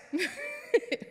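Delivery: a short embarrassed laugh at a slip; laughing at her own mix-up; high amused chuckle; real laugh. Real, not acted. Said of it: "I got a little lost".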